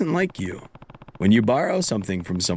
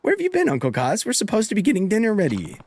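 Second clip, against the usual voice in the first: put-on much higher, quick girl voice; young girl; 10 semitones higher, faster than usual. Haruka scolding Uncle Kaz about dinner, high and quick. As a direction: chide him like a busy little girl.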